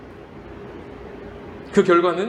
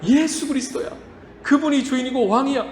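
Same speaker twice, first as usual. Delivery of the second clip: proclaiming preaching; 7 semitones higher than usual. Acted. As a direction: declare it to the whole church. Proclaiming the gospel.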